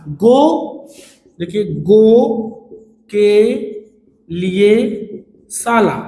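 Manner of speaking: गो के लिए शाला said slowly with a pause before each word; stretched apart word by word. Shown as a demonstration. Teacher to students, spreading the word out: slow, paused words. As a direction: spell out गो... के... लिए... शाला in separate beats. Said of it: "शब्द को थोड़ा सा फैलाएंगे".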